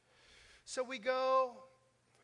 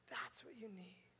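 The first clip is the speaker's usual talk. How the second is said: whispered, softer and lower; hushed whisper; 4 semitones lower, 13 dB quieter than usual. Real, not acted. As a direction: drop to a whisper to draw them in. Hushed preaching climax.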